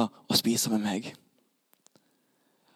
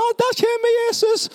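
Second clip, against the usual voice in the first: high-pitched thrilled cry; excited sighting; 3 dB louder than usual. Acted. Zacchaeus in the tree spots Jesus, high excited cry. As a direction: cry out way up high on spotting him.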